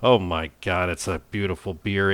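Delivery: usual speaking voice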